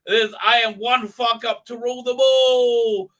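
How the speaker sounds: high, loud grand declaration; mock Galadriel. Mocking Galadriel with a loud grand declaration. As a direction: declaim it like a self-important queen. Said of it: "Gladriel's like".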